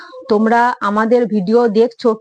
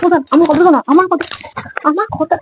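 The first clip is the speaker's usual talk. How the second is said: high-pitched, insistent plea; urgent pleading; 7 semitones higher than usual. Real, not acted. Wife begging angry husband to listen, urgent pleading.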